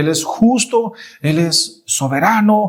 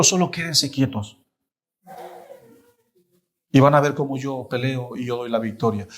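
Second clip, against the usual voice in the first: softer, hushed and calm divine promise; quiet voice of God; 4 semitones lower, 6 dB quieter than usual. Acted. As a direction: speak low as God reassuring his people. God speaking to his people.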